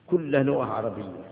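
normal talking voice

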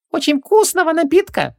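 very high gurgling genie voice. A narrator's reading. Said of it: "пробулькал Хубаксис".

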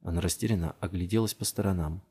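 plain reading voice

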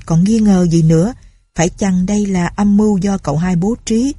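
ordinary delivery, nothing marked

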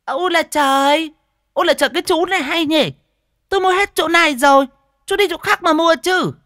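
very high, loud indignant shrieking; outraged at someone outbidding her; high, loud fishwife protest; shrill shrewish voice. Acted. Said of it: "mụ đanh đảnh".

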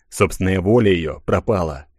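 plain reading voice

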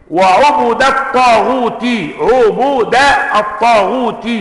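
وعبد الطاغوت slow, with damma on the ayn and the ba: wa-'ubudi t-taghuti; reading of al-Shabanudhi. Shown as a demonstration. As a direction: draw out عبد as 'ubudi, with الطاغوت ending in -ti. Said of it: "بضم العين والباء وكسر التاء". (وعُبُدِ الطاغوتِ بضم العين والباء)

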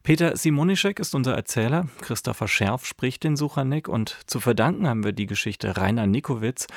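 ordinary talk

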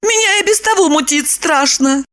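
high, complaining woman's voice; queasy Lipochka. A narrator's reading. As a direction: complain of sickness, pitched high.

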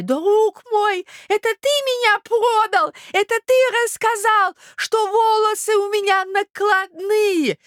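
very high, wailing accusation; old prince, betrayed. Acted. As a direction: reproach a friend in a drawn-out old voice. (старый князь, высоко и горестно укоряет)

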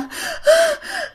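high, acted frightened gasp; gasp, too scared for words; terrified gasping. Sleeper too scared to answer the neighbours, high panicked gasping.

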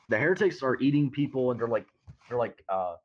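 ordinary talk, nothing marked

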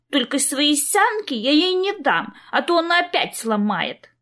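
put-on high, slow sulky child voice; pouty little girl. A narrator's reading. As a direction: pout like a stubborn toddler. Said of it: "закапризничала малышка".

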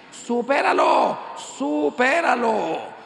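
loud, high, drawn-out command; emphatic shout. Acted. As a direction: cry it out slowly to the whole crowd. Preacher shouts the divine answer. (grito enfático y alargado)

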